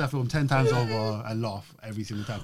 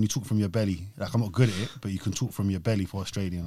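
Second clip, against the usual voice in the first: ordinary talk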